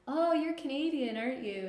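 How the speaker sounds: lightly voiced nosy stranger, starting high; curious stranger. Florida stranger remarking to a Canadian, curious stranger. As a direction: open high and loud as if just spotting it.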